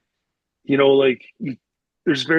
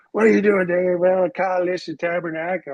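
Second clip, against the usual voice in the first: higher-pitched, agitated coach's rant; retold scolding, pitched up throughout; mock cursing coach; 5 semitones higher than usual. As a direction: imitate a coach cussing a player out. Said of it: "like, mother effing me and".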